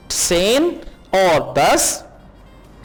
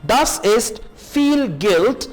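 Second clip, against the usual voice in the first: slow model reading; much slower than usual. Shown as a demonstration. Slow German model phrase.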